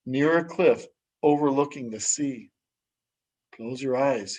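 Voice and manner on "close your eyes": gentle hush. Acted. Brother gently guides her wish.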